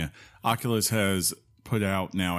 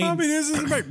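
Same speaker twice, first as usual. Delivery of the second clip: sudden high excited shout; 4 dB louder than usual. Real, not acted. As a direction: yell it out, way up high. Excited outburst at friend.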